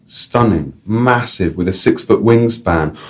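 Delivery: ordinary talk, nothing marked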